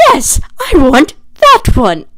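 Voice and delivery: drawn-out, loud, high "yes, I want"; retold burst of eager wanting; eager exclamation.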